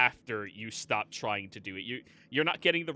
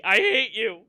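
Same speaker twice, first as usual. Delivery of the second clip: high, loud squawking complaint; angry familiar voice; 10 semitones higher, 10 dB louder than usual. Acted. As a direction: yell it like a cranky bird. Familiar crashes, owner voices it.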